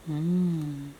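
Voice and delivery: usual speaking voice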